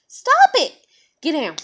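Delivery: sharp high loud shout at the dog; yelling at dog. Real, not acted. Owner shouting at her dog on the bed, high and loud. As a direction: snap the dog out of it.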